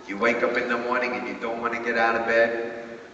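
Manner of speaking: usual speaking voice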